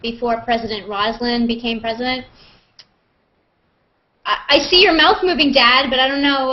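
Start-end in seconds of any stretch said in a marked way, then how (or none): 4.2-6.5 louder, higher, quicker call to dad; telling dad his sound is off. Real, not acted. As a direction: push it out so dad hears you.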